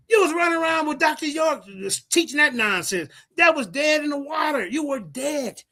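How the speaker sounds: high, heated call-out; heated accusation. Real, not acted.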